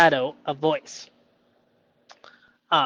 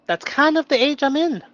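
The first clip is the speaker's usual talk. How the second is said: put-on much higher, louder childlike squeak; playful high voice; 11 semitones higher, 7 dB louder than usual. Joking he is two years old, childlike high voice. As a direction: squeak it like a toddler. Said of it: "playful self-mockery".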